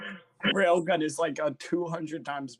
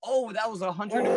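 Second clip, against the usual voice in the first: fast, higher, louder disbelief; astonished; 6 semitones higher, 5 dB louder, faster than usual. Real, not acted. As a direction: blurt out the number in shock. Astonished at huge damage.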